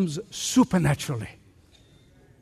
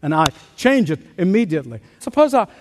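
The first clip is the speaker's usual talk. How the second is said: ordinary talk, nothing marked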